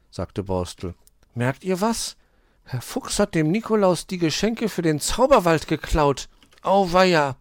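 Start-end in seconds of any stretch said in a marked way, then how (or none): 1.3-7.4 put-on high, loud child-like hedgehog voice; excited little hedgehog. A narrator's reading. Borstel tells Hoppel about the crooks, excited. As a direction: report the crime in a high, urgent voice.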